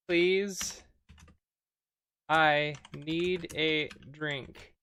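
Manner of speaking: slow, word-by-word dictation with gaps; halting, spaced-out typing voice; dictating while typing. Real, not acted. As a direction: read out each word as you type it. Types commands aloud.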